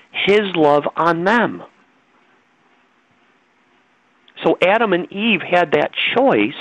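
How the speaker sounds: rising, slow, impassioned preaching; emotional. Real, not acted. Preacher pressing Elisa about free choice, impassioned. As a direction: build it up slowly like a sermon. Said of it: "pardon me if I get a little emotional here".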